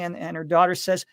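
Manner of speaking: usual speaking voice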